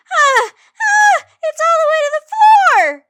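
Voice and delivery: shrill-free high loud wail of dismay; yelling. A narrator's reading. May wailing about her ruined sweater, loud and high. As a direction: scream it out in horror. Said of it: "she yelled".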